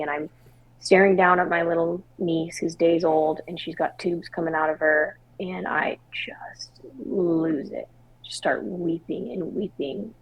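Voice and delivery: normal talking voice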